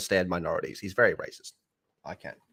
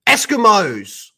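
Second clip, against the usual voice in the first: triumphant outburst; 9 semitones higher, 8 dB louder, slower than usual. Real, not acted. Remembering the word, calls it out.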